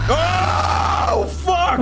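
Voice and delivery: high startled yelp; startled shout. Real, not acted. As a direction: yelp in sudden fright.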